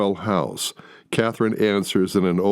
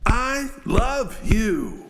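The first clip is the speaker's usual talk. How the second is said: much higher, slow cry of I love you; shouting; much slower than usual. Acted. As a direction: cry it out as you swing at your brother. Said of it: "as he shouts".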